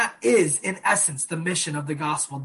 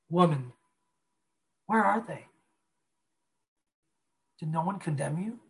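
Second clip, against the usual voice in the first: much softer, gentle and tender; quiet fatherly voice; 5 dB quieter than usual. Retold or imitated. Pastor voicing Jesus to the frightened woman, tender and quiet. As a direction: speak softly like a loving father. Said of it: "with that quiet voice of a father".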